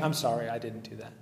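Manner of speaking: understated sarcastic sorry; facetious sorry. Retold or imitated. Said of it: "Obviously being very facetious".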